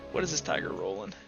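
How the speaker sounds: ordinary talk, nothing marked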